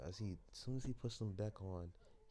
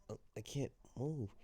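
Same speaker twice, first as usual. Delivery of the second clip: alarmed; 7 semitones higher, 3 dB louder than usual. Acted. Izuku suddenly paralyzed in chair.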